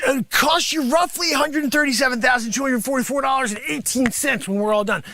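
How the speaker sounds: usual speaking voice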